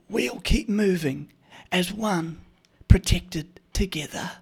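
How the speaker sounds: high, hushed, slow rasp; like a match went down her throat; raspy ad lady. Retold or imitated. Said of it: "the lady sounds like she lit a match down her throat".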